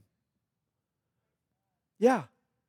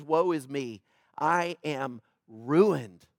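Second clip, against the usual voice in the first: hushed, low and very slow, drawn out; quiet, slow lament; despairing; 8 semitones lower, 5 dB quieter, much slower than usual. Retold or imitated. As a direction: sink into it like a broken man. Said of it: "He says".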